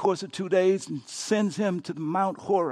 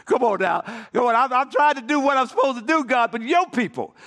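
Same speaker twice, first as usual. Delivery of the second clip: whiny complaint; 4 semitones higher than usual. Acted. Elijah complaining to God.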